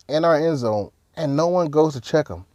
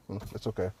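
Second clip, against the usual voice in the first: muttered mock shrug; 11 semitones lower, 19 dB quieter, much faster than usual. Mock shrug from a rival fan.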